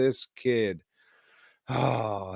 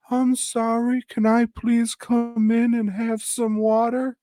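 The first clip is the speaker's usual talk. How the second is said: much higher, meek and pleading; pleading kid voice; 14 semitones higher, 4 dB louder than usual. Retold or imitated. Orphan begging the staff at dawn, high and meek. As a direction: beg sweetly to be let back in.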